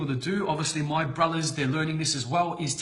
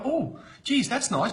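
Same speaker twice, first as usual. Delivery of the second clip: mock impressed gasp; 7 semitones higher, slower than usual. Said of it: "people go".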